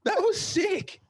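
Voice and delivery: high, loud, laughing excitement; delighted amazement. Real, not acted. Host praising guest's accent trick, real excitement. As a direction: burst out with thrilled praise.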